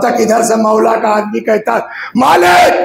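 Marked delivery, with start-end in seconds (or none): 2.2-2.7 shouted call, higher and louder. Retold or imitated. The Imam's man calling Malik back from battle, shouting. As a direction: call him back across the field.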